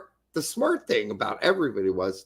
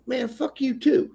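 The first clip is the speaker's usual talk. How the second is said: higher-pitched mock retort; retold defiant comeback; 9 semitones higher than usual. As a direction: throw it back, raised pitch. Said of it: "And I'm like".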